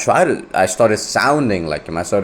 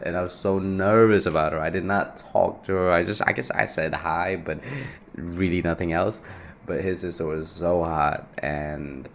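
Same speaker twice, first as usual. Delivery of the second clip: ordinary talk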